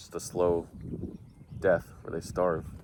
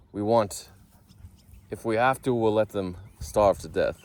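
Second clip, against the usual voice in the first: bad Israeli accent; 2 semitones higher, 5 dB louder than usual. Quoting the Knesset in a newspaper headline, bad Israeli accent. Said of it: "that was a bad accent".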